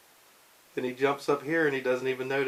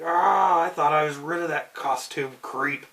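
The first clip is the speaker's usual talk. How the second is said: mad; 4 semitones higher, 5 dB louder than usual. Acted. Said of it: "So he's all mad".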